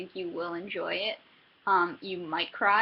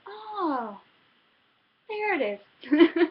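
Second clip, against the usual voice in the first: high, drawn-out "oh, there it is"; mock surprise; 7 semitones higher, 6 dB louder, much slower than usual. Reader finally finding the missing voice, high and slow. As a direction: act out the reader's slow realisation, pitched up.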